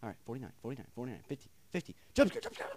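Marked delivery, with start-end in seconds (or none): 2.1-2.7 very high, loud panicked squeal; startled shriek. Real, not acted. Horror game player expecting a jump scare, startled shriek. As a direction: blurt it out in a high-pitched fright.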